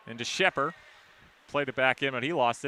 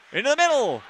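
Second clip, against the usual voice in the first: excited call; 11 semitones higher, 6 dB louder than usual. Real, not acted. Scramble in front of net.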